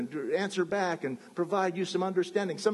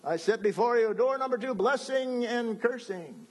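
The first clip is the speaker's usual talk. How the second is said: God offers the doors like a game show host; 6 semitones higher than usual. Acted. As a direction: present the prizes like a game show. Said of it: "here's God saying".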